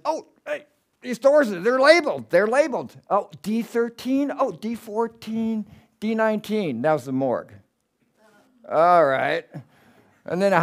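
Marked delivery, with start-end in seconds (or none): 0.0-6.8 high, dawning surprise, slowing on the numbers; surprised discovery. Retold or imitated. Finding the morgue door labels, high and amazed. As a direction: realize it bit by bit, amazed.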